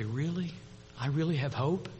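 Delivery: very soft, tentative question; hushed disbelief. Acted. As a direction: ask softly, as a survivor daring to hope. Survivor answers in timid disbelief.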